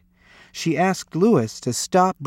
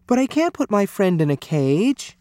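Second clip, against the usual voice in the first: put-on higher-pitched child's protest; mild distressed cry; 6 semitones higher than usual. A narrator's reading. Lewis protesting the zoo to his parents, mildly distressed cry. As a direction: plead upward, a touch of upset. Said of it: "cried Lewis".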